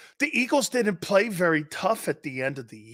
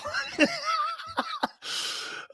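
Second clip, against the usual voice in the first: very high, thin shriek of laughter; 4 dB quieter than usual. Real, not acted. Host losing it laughing on air. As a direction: squeal with laughter.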